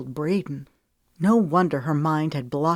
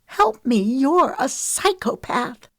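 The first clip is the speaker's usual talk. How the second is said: much higher and louder, slow on psychopath; shrill pitch; 8 semitones higher, 4 dB louder, slower than usual. A narrator's reading. Lemon screaming at Wesley on the phone, shrill and slow. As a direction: shriek it out in disbelief. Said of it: "Her voice rose to a shrill pitch".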